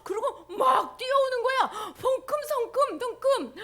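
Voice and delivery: excited narration. A narrator's reading. Narrating father running.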